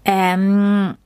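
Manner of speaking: hesitant drawn-out hum; throat-clearing. A narrator's reading. Max nervously addresses the creature, clearing his throat. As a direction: stall with a long, hesitant hum. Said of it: "räusperte sich Max".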